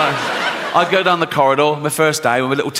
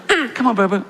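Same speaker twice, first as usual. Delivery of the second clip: coaxing wife; 8 semitones higher than usual. Retold or imitated. Wife coaxing chimp husband.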